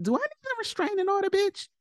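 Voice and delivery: high, fast mocking taunt. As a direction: taunt him with a high sarcastic voice.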